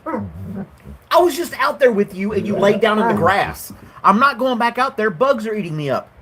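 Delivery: talking to the dog. Real, not acted.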